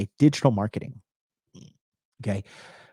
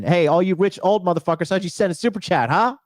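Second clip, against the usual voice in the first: mock taunt; 6 semitones higher than usual. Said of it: "No, I'm just joking".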